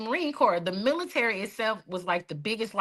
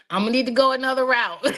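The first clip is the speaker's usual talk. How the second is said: laughing; 4 semitones higher, 6 dB louder, faster than usual. Real, not acted. Joking about refusing church therapy.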